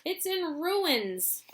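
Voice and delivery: high drawn-out dismay; mild lament. Acted. Mister Bucket about the house, slow lament. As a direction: mourn it slowly.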